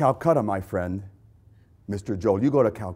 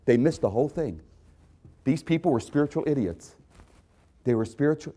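ordinary talk

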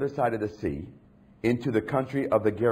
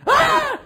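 sudden high, loud scream; acted scream; 9 dB louder than usual. Preacher acting the possessed man among the tombs, screaming. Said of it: "he was screaming among the tombs".

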